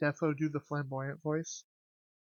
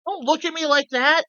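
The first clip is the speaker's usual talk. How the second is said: indignant shout; 11 semitones higher, 11 dB louder than usual. Real, not acted. Reacting to chat teasing.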